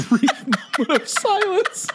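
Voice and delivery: high breaking laugh mid-sentence. Real, not acted. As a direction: crack up as you say it. Laughing at the silence.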